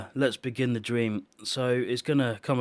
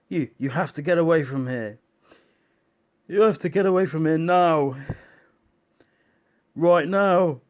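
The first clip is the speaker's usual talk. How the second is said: loud urgent order to flee; urgent warning; 5 semitones higher, 6 dB louder than usual. Retold or imitated. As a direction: bark the warning, rising to a shout. Japanese officer warning of danger.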